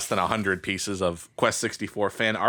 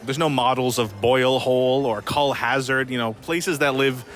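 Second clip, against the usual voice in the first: documentary narration; 3 semitones higher than usual. Acted. Voiceover for a played clip.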